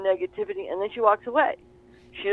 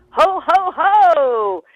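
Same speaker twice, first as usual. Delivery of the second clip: big booming ho ho ho, higher and louder; jolly Santa laugh; 7 semitones higher, 10 dB louder, much slower than usual. Retold or imitated. Client turning to her family, a booming Santa ho ho ho. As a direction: belt it out like Santa breaking the tension. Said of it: "She turns to them and she goes".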